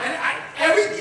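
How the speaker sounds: ordinary delivery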